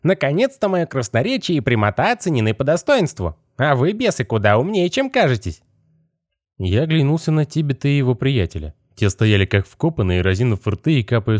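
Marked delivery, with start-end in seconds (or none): 0.0-5.6 high, otherworldly skeleton voice. Acted. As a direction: pitch it way up like a rattling skeleton.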